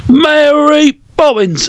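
high, drawn-out bad British accent. Joking about a bad British accent, mock high-pitched Cockney. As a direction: put on a hammy, slow, high British voice.

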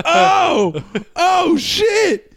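a friend recoiling at the sight; shocked friend. Retold or imitated. Friend reacting to knee.

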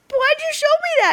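high distressed wail of a question; panicked cry. Retold or imitated. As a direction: cry out as if betrayed. Retelling freakout at card.

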